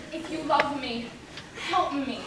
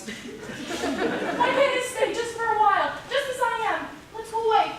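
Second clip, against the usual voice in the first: higher, louder, slow tearful begging; pleading; 6 semitones higher, 5 dB louder than usual. Acted. Bride begging her father to run away, a tearful plea. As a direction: beg him to take you away.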